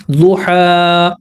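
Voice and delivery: दुहा slow, the last vowel drawn out on the stop; stretched stop. Shown as a demonstration. Teacher showing students how to stop on दुहा, drawn out. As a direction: pull दुहा out long at the pause. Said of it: "ऐसे पढ़ेंगे।".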